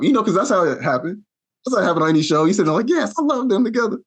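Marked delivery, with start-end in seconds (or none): 2.9-4.0 much higher, gushing fan voice; a mock-excited viewer on the couch. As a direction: squeal like you're rooting for them. Viewer cheering for a couple.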